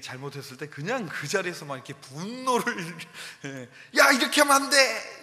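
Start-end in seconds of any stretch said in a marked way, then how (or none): 4.0-5.2 loud, high scolding shout; father reenacting yelling at his children, angry shout; angry yell at kids. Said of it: "이렇게 했었는데".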